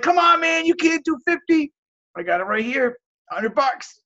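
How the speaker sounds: pressing a stubborn seller to take the hundred; pleading seller pitch. Retold or imitated. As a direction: beg playfully, cash held up.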